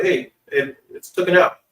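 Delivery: ordinary talk, nothing marked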